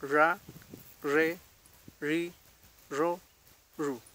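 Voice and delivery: RA, RE, RI, RO, RU one at a time with pauses; syllable drill. Shown as a demonstration. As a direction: model each of RA, RE, RI, RO, RU with untrilled r. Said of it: "con una vocal".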